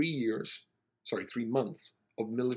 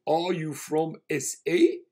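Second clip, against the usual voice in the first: slow, louder, higher South African voice; surprised South African; 7 semitones higher, 10 dB louder, slower than usual. Retold or imitated. Passenger asks the rabbi where he's from, South African accent. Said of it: "And he said".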